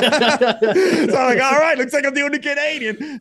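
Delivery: high, loud talking through laughter; laughing resignation. Retold or imitated. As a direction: retell giving in, cracking up as you go. Laughs retelling his forced agreement.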